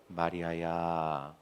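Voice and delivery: very soft, low, slow tender call; Jesus. Acted. Preacher as Jesus calling Mary by name, tender and hushed. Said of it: "정말 다정하고".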